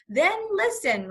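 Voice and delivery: mild outraged roar. A narrator's reading. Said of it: "roared Mister Popper".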